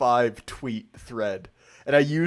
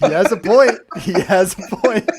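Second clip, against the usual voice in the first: stoned musing; 7 semitones higher, 10 dB louder than usual. Retold or imitated. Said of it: "reading through it like".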